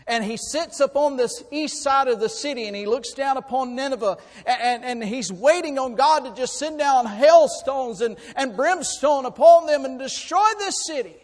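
loud, high, driving sermon delivery; full-force preaching. Real, not acted. Preacher telling the congregation of a changed city, full-force preaching.

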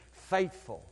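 usual speaking voice

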